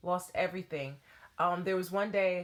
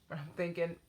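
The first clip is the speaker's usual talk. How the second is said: ordinary talk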